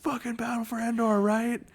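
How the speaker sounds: high excited fan voice; fanboy echo. Pretend. Friends riffing on a movie moment: high excited echo. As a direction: join in the fanboy cheer.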